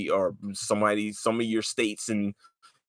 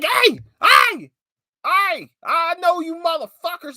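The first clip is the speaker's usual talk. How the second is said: mock tough guy with a loud, very high, light voice; exaggerated squeaky-high imitation, drawn out; Stephen Amell's light voice; 6 dB louder, slower than usual. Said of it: "with a light-ass voice".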